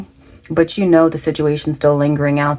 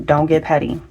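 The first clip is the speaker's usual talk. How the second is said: ordinary delivery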